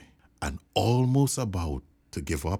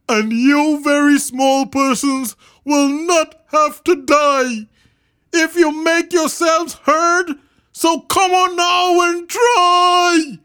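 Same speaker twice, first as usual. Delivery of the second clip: big high character voice, calling loudly; rally them with a loud, high earnest call; Horton calling out; 11 dB louder than usual. A narrator's reading. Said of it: "managed to call to the mare".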